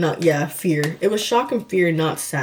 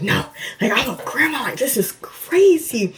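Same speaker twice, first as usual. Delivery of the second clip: imitating an elated mom in a higher voice; excited; 7 semitones higher than usual. Mom learning she's a grandma, excited. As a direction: pitch it up, stretching "crazy" in excitement. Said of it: "the most excited I've ever seen my mom".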